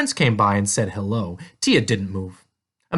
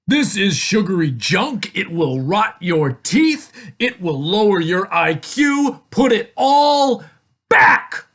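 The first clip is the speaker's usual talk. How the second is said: loud, raised, emphatic telling-off; scolding father; 7 semitones higher, 5 dB louder, slower than usual. A narrator's reading. Father orders Tia to put it back, exasperated scolding. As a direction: build to a drawn-out, punched last order.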